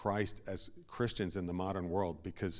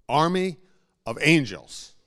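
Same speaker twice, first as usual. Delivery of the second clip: booming proclamation, much louder and higher; shout; 7 semitones higher, 13 dB louder than usual. Real, not acted. Preacher thundering to the congregation about angels. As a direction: cry it out to the whole room.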